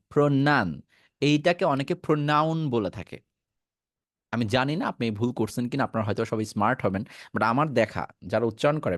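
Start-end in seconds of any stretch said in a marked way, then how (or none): none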